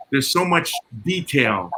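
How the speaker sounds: ordinary delivery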